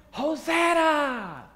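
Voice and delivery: crowd shouting. Acted. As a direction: cry it out like the joyful crowd. Crowd acclamation acted in sermon.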